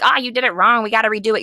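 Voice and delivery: ordinary delivery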